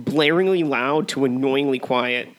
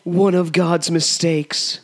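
higher and slow, lightly theatrical; slow dramatic quote; 4 semitones higher, much slower than usual. Acted. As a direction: drag out each word for drama. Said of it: "a good old-fashioned quote war".

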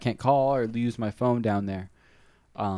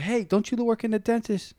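higher voice for the cousin; 11 semitones higher than usual. Retold or imitated. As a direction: pitch up for the young cousin. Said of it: "the cousin's like".

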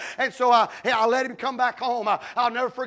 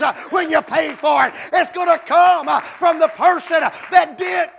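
loud, higher shouted preaching; preaching at full force; 4 semitones higher, 7 dB louder than usual. Acted. Pastor proclaiming where revival comes from, shouting. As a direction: drive it home at full voice.

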